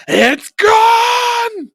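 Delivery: high, loud, exasperated cry; loud outburst finishing the friend's line; excited shout. Real, not acted. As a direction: blurt it out at the top of your voice. Both exclaiming the thing is gone.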